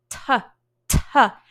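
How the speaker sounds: loud, separate "Ta, ta" with puffed T; T-sound demo. Shown as a demonstration. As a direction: burst air out on each "ta". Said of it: "what is the difference".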